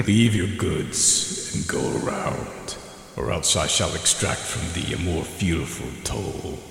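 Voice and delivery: slow, low rumbling ancient voice; a woken god's menacing demand; guttural booming voice. Acted. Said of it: "a guttural booming voice comes out at you".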